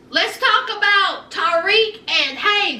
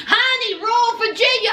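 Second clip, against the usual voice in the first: usual speaking voice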